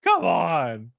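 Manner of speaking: high wailed no easing into whiny pleading; hammed-up protest; mock dismay. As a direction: plead in playful outrage. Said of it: "you're like".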